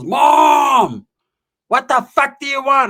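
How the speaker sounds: loud, high shout into the house; yelling to mom. Retold or imitated. Friend hollering to his mom for burgers.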